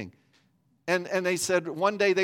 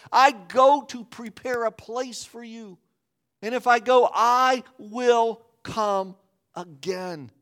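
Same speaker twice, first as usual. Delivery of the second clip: high, slow, rising proclamation; slow, lifted voice of promise; solemn preaching; 7 semitones higher, 6 dB louder than usual. Acted. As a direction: deliver Jesus' promise slowly and grandly. Quoting Jesus at sermon peak.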